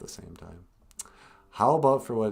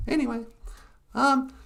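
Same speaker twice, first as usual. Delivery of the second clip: puppet voice; 8 dB louder than usual. Acted. Puppet Natasha introducing herself, very high and loud. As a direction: squeak it out as the puppet.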